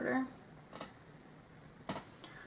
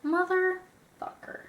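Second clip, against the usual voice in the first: frustrated curse; 7 semitones higher, slower than usual. Real, not acted. Eyeliner smudges again.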